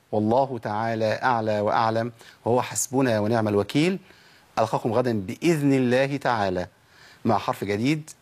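usual speaking voice

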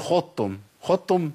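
خضتم quickly as 'khottum', ض swallowed into ت; merged, wrong. Shown as a demonstration. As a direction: run خضتم together with a double t. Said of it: "كتير من الناس بيقول".

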